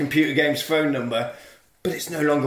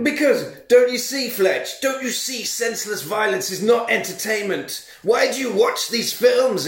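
higher, lecturing, scolding; preachy teacher; 7 semitones higher than usual. Retold or imitated. Teacher lecturing Edward about violence, preachy. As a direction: sermonise like a moral teacher.

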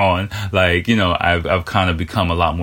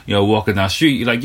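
ordinary delivery

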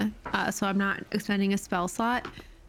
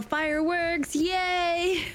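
high, drawn-out cheer; playful celebratory yell; 7 semitones higher, slower than usual. Acted. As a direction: call it out in a high, gleeful singsong. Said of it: "And I yell".

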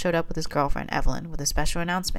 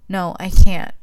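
louder, lightly tearful protest; starting to cry; 3 semitones higher, 11 dB louder, slower than usual. A narrator's reading. Patient in labour refusing to push, mildly tearful. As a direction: plead loudly with a touch of tears. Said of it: "starting to cry".

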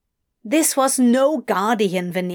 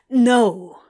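loud, firm refusal; fierce; 5 dB louder than usual. Acted. Crowley holding Adam close, fierce. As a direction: refuse it with force. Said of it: "Crowley said fiercely".

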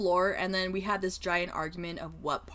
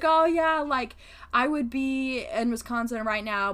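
excited; 6 semitones higher, 6 dB louder, slower than usual. Retold or imitated. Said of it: "she was so excited".

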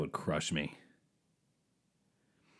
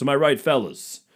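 hack comic catchphrase; 4 semitones higher, 5 dB louder than usual. Pretend. Repeated catchphrase louder.

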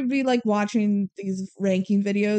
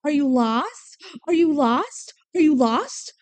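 a mock-sweet dog-lady voice climbing higher each time; repeated rising question; 5 semitones higher, 3 dB louder than usual. Imitating the woman fussing over the dog, rising. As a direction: fuss over the lost dog, pitch creeping up. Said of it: "and she goes".

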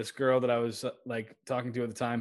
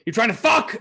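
much higher, louder mock tough-guy challenge; mock angry challenge; 9 semitones higher, 7 dB louder than usual. Hothead squaring up to someone, high mock angry challenge. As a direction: act like a hothead squaring up, pitched up.

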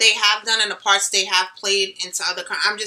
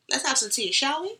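soft, playful British tea-time voice; mock British accent; 5 semitones higher, 5 dB quieter than usual. Podcaster jokingly inviting co-host to tea, mock British accent. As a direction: put on a dainty British accent, lifting at the end. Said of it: "You're still with the accent".

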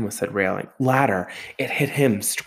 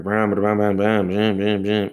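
imitated chainsaw noise; slower than usual. Chainsaw running in the corner.

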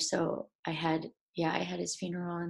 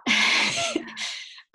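tearful high laugh; 4 dB louder than usual. Real, not acted.

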